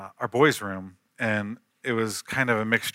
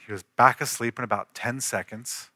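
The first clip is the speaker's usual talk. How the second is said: ordinary talk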